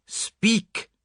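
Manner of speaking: detective menacing the silent suspect; menacing command. Acted. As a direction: loom over him and order it. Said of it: "he cried menacingly".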